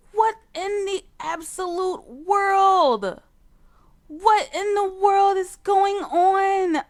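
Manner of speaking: high, slow, loud shocked outcry; stunned, drawn-out what; shocked disbelief. Real, not acted. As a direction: gasp it out in disbelief. Shocked reaction to Sims kiss.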